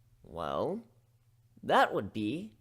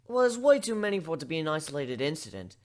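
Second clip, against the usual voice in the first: plain reading voice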